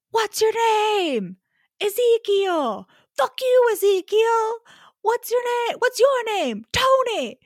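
high-pitched mock screaming match; imitated screaming. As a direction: screech it like two men cursing across a distance. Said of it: "And then they just scream".

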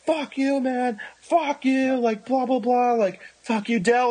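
high-pitched imitation of an angry man; mock screaming. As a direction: mimic a stranger yelling abuse from afar. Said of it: "started screaming at the back".

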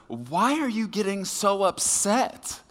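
high, slow, sugary question; a spouse in a fight, asking in mock sweet concern; sweet condescension. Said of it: "One of the spouses says".

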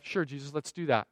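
low, soft, casual yes; lightly acted, low-key consent; easygoing agreement. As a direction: agree quietly, as to a friend. Voices Simon agreeing to request.

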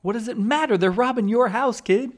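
high, incredulous rant; exasperated. Acted. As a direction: throw up your hands at it. Mocking the movie's psychopath.

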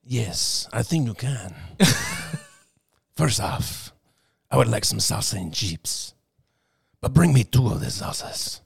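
a hint of a smooth Spanish accent, lightly voiced; light Banderas-style Spanish accent; Antonio Banderas impression. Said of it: "Channel Antonio Banderas".

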